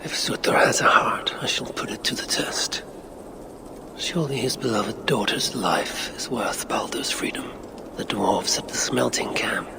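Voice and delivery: hushed inner-monologue whisper; whispered thoughts. Acted. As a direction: breathe it low to yourself, plotting.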